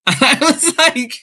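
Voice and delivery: high, slow, loud laughing outburst; incredulous laughter; laughing disbelief. Real, not acted. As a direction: laugh through the words in disbelief.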